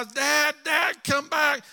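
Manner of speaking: high pleading call after a father; crying child calling. Retold or imitated. Small boy calling after his father, high and pleading. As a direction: call him back like a scared little boy.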